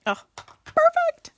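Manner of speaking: high loud thrilled "perfect"; delighted squeal. Real, not acted. Delighted at the piece fitting.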